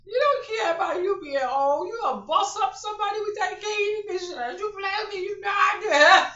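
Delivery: loud, high, cracking up through the words; teasing her mom through fits of laughter; laughing yell. Retold or imitated. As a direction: yell it while laughing hard. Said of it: "I said".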